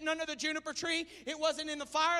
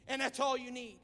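ordinary talk

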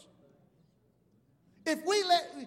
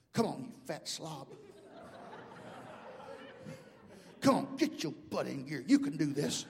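self-berating pep talk; 3 semitones lower than usual. Acted. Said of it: "I'll tell myself".

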